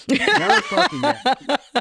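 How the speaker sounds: comic laughing through his own punchline. Real, not acted. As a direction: crack up on the insult.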